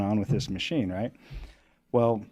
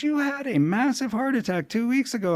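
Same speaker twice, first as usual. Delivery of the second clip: scolding doctor. Retold or imitated. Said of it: "He goes".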